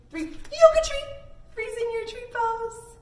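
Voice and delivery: sudden high loud shout; game call. Acted. Teacher springs the call on the kids, loud and high. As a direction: burst out with it.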